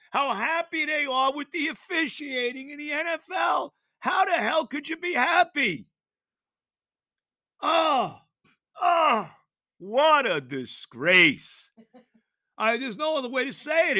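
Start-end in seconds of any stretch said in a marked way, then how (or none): 0.1-5.9 pitched up, angry outburst; furious yell. Real, not acted. Fan ranting at the league, furious raised yell. Angry rant about officiating. 7.6-9.3 disgusted groan. Real, not acted. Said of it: "disgust exclamation". 9.8-11.7 slow, louder outcry; loud drawn-out disgust. Real, not acted. Fan crying out slowly at the disgrace. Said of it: "earlier loud take".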